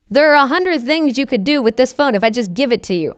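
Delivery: ordinary talk, nothing marked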